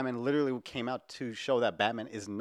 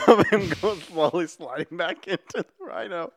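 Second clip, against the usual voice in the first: laughing while telling; 6 semitones higher, 5 dB louder than usual. Real, not acted.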